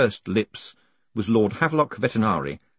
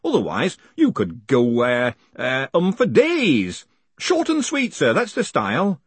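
animated hangman voice, jumping high; annoyed hangman; 4 semitones higher, 3 dB louder than usual. Acted. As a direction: chivvy the prisoner along.

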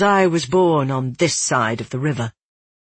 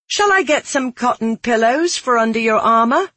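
high, raised-voice mocking question; loud taunt; 8 semitones higher, 4 dB louder than usual. A narrator's reading. Blacksmith's daughter taunting Savar before a crowd, loudly. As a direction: pitch it up for onlookers to hear. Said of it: "I asked loudly".

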